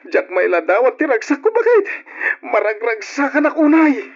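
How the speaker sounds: high, loud outpouring of joy; overjoyed. Acted. Husband tells wife how happy he is, overjoyed. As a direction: proclaim happiness at the top of the voice. Husband declares his great happiness.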